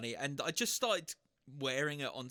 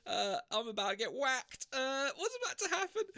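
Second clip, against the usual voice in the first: rising higher and higher in mock terror; mock panic; 9 semitones higher than usual. Walking down to the knife-sharpening boss, hammed-up panic. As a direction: play up the dread, voice climbing. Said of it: "I'm freaking out".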